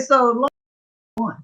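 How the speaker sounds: ordinary talk, nothing marked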